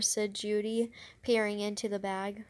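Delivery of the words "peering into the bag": ordinary delivery, nothing marked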